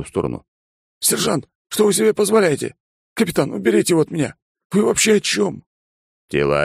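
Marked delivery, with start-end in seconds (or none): 1.0-2.7 shrill pilot in a panic; panicked pilot. Acted. Bond panicking at Alex. 3.1-5.6 fast, high frantic plea; panicked plea. Acted. Bond begging.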